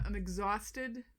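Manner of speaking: ordinary talk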